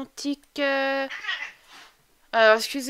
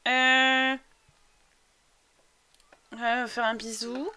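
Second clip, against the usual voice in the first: ordinary delivery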